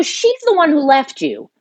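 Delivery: high, insistent pitch at normal volume; retold accusation. Acted confrontation with her brother.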